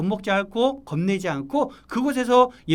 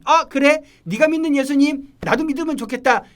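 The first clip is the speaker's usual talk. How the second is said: high, louder, keen reply; acted eager interest; 7 semitones higher, 6 dB louder than usual. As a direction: play a friend happily won over. 전도받은 친구의 반가운 반응 연기. (솔깃해서 반기는 친구 연기)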